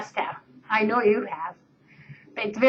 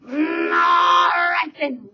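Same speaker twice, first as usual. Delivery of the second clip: troll roar; 5 semitones higher, much slower than usual. Acted. Troll letting the goat pass, slow roar. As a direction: drag it out like a troll. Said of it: "roared the troll".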